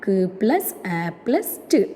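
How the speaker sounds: K, A, T as "kuh, æ, tuh"; sounding out K-A-T. Shown as a demonstration. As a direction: sound out each of K, A, T, not letter names. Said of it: "The vowel sound A". (K, A, T ശബ്ദങ്ങളായി വേർതിരിച്ച്)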